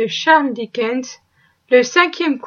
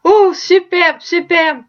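delighted; 3 semitones higher, slower than usual. A narrator's reading. Scrooge sees bright morning.

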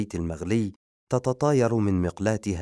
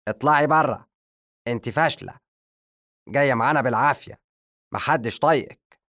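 higher, louder, fast harsh scolding; stern; 5 semitones higher, 4 dB louder than usual. Acted. The magician throwing Madiha out, loud stern rebuke. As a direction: cut her off and tear into her. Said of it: "قاطعها الساحر بصرامة".

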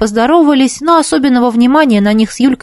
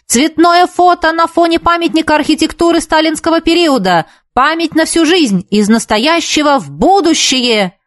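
yelling; 8 semitones higher than usual. A narrator's reading. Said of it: "заорал он".

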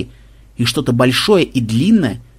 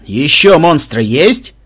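booming, louder, slow from a helmet; inside a knight helmet; 6 dB louder, much slower than usual. A narrator's reading. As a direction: boom it out through a visor. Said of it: "прогудело из-за шлема".

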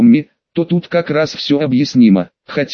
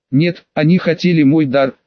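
usual speaking voice

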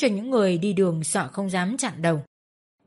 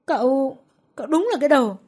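high, louder sputtering anger; huffy retort; 6 semitones higher, 4 dB louder than usual. A narrator's reading. Diễm snaps at Nam, sputtering angrily. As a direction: sputter in rage. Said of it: "Diễm tức tối".

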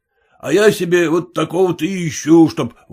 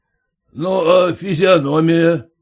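usual speaking voice

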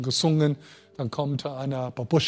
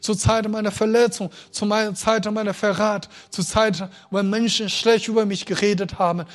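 rising, impassioned preaching; climbing sermon intensity; preaching at full force; 5 semitones higher, 4 dB louder than usual. Acted. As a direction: build the list to a fervent cry. Preaching climax, louder and higher.

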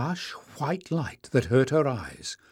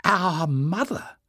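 higher, slow, disbelieving; stunned question; 6 semitones higher, slower than usual. Acted. Jasmine, stunned, asking about their mother. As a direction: let it fall out in shock.

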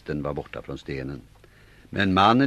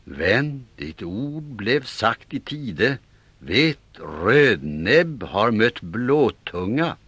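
högre, långsam högtidlig vers; förändrad skaldisk röst; 6 semitones higher, 4 dB louder, much slower than usual. Acted. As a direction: svara i vers med annan röst. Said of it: "hans röst blev en annan". (högtidlig, långsam skaldevers)

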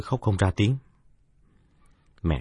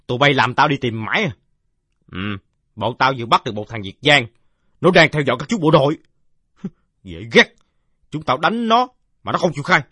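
put-on loud, high shouting boy; boyish shout; 6 semitones higher, 6 dB louder than usual. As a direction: yell it out like a rowdy village kid. Boy arrives shouting at captive. (giọng thằng bé quát to)

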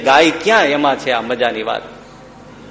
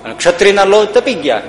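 ordinary delivery

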